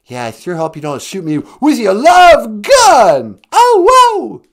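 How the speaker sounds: rock frontman howl, high and very loud. Acted. Rock singer pleading not to be shot, wailing frontman voice. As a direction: build to a huge stage-banter wail. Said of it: "[name], ladies and gentlemen".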